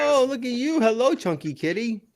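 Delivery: high, soft pet-greeting voice; cooing to a cat. Real, not acted.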